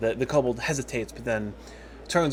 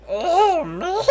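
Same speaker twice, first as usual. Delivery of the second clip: very high squeaky "oh no"; high-pitched kobold voice; worried kobold; 5 dB louder than usual. Acted. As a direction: squeak it loudly like a small creature in dread. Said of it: "And Snaggletooth goes".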